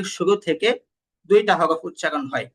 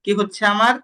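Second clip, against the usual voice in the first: ordinary talk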